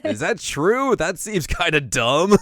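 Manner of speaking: high, loud doubtful question; hammed-up disbelief, slowing at the end; mock incredulous. Mother doubting the crying demon's excuse, mock incredulous.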